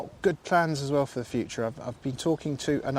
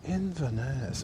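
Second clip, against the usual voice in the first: playfully bad Scottish lilt; badly faked Scottish accent; imitated mock Scottish accent; 3 dB quieter, slower than usual. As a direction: copy a friend's hammed-up fake Scottish. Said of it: "I say it like that".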